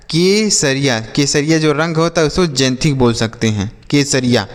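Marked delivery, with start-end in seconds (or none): none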